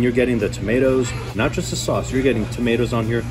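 normal talking voice

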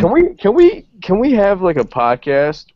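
high, loud sudden excitement; excited. Real, not acted. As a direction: burst out with the idea. Excited idea.

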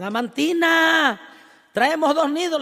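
high, loud, drawn-out shout from afar; yelled call. Retold or imitated. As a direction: yell it to her from outside. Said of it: "Y le gritaban".

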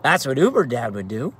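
ordinary delivery

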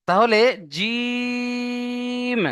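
jim held for three alif lengths; the long vowel held like Quran recitation; long drawn jim. Shown as a demonstration. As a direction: stretch জিম out long and steady. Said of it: "তিন আলিফ টান দিতে হবে".

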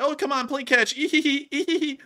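high, teasing voice with impish giggles; playful taunting Ukrainian. Acted. Drone pilot teasing a Russian to play, high playful taunt. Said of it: "And the Ukrainian's like".

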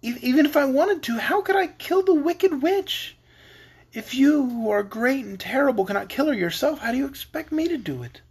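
put-on high-pitched tearful child; sobbing. A narrator's reading. Little girl asking how she could kill, sobbing. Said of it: "she sobbed".

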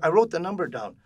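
lightly acted, high and loud mom; retold mother. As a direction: answer loudly as an imitated mom. Retold mother answering her son.

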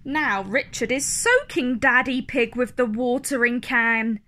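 ordinary delivery, nothing marked

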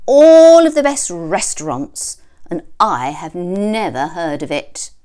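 loud, high, boastful wolf; pompous wolf. A narrator's reading. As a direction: brag like a snooty gourmet. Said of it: "said the wolf".

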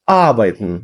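Arbeiten with the stress on the first A. Shown as a demonstration. Teacher showing a student the stress in Arbeiten. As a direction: land the accent on the A in Arbeiten. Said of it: "На А наголос".

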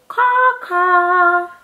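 high cawing; crow caw. Shown as a demonstration. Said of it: "Can you cackle like a crow".

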